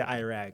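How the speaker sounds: "Iraq" said as eye-rack; "Iraq" the American way, opening on eye; American pronunciation. Shown as a demonstration. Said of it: "you know how they say".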